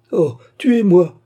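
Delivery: high, loud, drawn-out cry; anguished slow wail. A narrator's reading. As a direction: cry out in despair. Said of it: "s'écria la pauvre fille".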